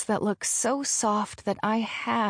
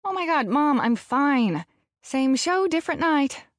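higher-pitched, fed-up daughter; exasperated teen; 6 semitones higher than usual. A narrator's reading. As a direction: brush off a fussing mom. Exasperated daughter brushes off mom.

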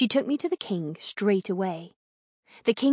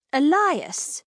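higher, louder scolding cry; sharp rebuke; 6 semitones higher, 5 dB louder than usual. A narrator's reading. Althea scolding the needling king, sharp exclamation. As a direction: cut him off with his name.

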